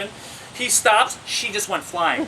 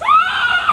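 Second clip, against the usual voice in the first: very high, loud screech; imitated monkey screech; 9 dB louder than usual. Monkey jumping up at the drive-thru window, screeching.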